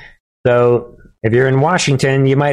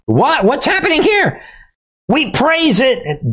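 much higher, squeaky mock disbelief; mock baffled outrage; 12 semitones higher than usual. Mocking the Saudi oil news, high and incredulous. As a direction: squeal it like you can't believe it.

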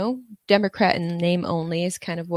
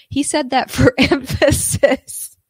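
laughing through it, slow and louder; 7 semitones higher, 5 dB louder, slower than usual. Real, not acted. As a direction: break into a laugh mid-line. Laughs at the excuse.

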